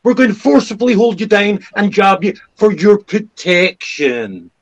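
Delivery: high, loud mock official spin; sarcastic Newspeak mockery. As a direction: sneer it like government doublespeak.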